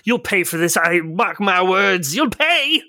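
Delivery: sputtering foiled villain. Acted. Jockey shaken, threatens revenge.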